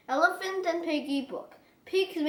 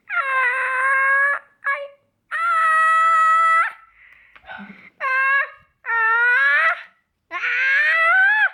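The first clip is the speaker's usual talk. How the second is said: ahs rising higher and louder to a shout; sneeze wind-up; 11 semitones higher, 8 dB louder, much slower than usual. Acted. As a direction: build a huge sneeze. Gerald wind-up to big sneeze.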